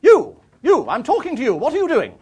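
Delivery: high, loud, commanding hail; imperious shout demanding an answer; bellowed call. Retold or imitated. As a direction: imitate a formidable countrywoman hailing someone. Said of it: "she sort of called out".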